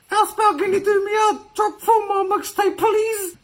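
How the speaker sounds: high pleading mock voice; high whiny voice. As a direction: whine it like a needy mate. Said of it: "The bro Jordan Lee reckons".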